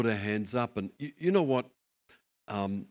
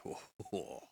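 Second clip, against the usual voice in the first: breathless whispered oh; 5 semitones lower, 12 dB quieter than usual. Acted. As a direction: breathe it out in awe. The Lord reacts, overwhelmed.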